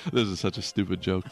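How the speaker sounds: low, laughing aside; amused. Real, not acted. Comic cracking up at his own joke, amused aside. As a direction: let a real chuckle leak into it.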